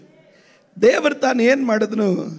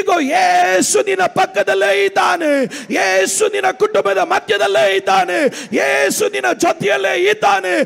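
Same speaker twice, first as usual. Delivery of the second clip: crying out; 7 semitones higher than usual. Real, not acted. Pastor answering those who ask what God has done, crying out. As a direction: cry it out to the whole congregation. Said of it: "ಕೂಗಿ ಹೇಳ್ತಾ ಇದ್ದೇನೆ".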